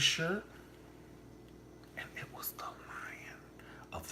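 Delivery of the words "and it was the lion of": whisper. Real, not acted. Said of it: "whispered reveal".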